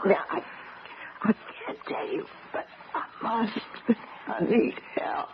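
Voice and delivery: very soft, fading whisper; faint whisper. Acted. Drugged wife whispering her plea for help to Becky, fading whisper. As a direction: force out a secret as the drug takes hold.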